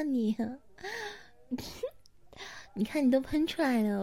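normal talking voice

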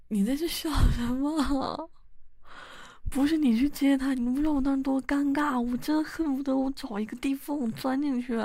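embarrassed scolding. Acted. Wife scolding her laughing husband, loud and flustered. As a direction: scold him, hot with embarrassment.

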